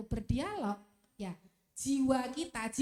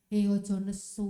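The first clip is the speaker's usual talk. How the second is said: low, firm, louder self-talk; acted low voice checking the anger; inner voice of reason; 6 semitones lower, 6 dB louder than usual. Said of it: "akan ada dialog".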